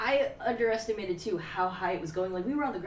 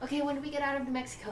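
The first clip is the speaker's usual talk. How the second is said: quick, high-pitched nagging; pestering; 7 semitones higher, much faster than usual. Acted. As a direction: fire off another impatient, played-up question. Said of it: "bug the hell out of Bronny".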